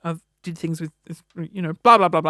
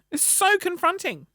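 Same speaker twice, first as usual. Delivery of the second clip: "so" pitched much higher, falling; higher mock-shocked repeat; 9 semitones higher than usual. Repeating to listeners in mock horror, higher "so". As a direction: repeat it jumping up high on "so". Same phrase said twice.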